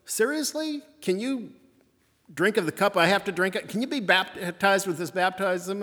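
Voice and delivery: Jesus testing James and John, mildly incredulous; challenging Jesus. Retold or imitated. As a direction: put the disciples on the spot, lightly acted. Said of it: "Jesus first responds and says".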